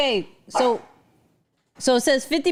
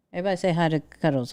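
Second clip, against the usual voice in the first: ordinary talk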